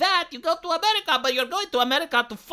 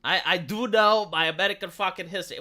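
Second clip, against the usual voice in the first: ordinary talk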